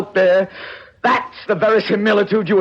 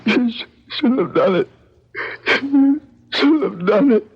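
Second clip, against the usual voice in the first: sobbing; 4 semitones higher than usual. Acted. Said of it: "I heard him sobbing".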